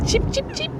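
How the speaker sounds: chick cheeping. Shown as a demonstration. Echoing child's chick sound.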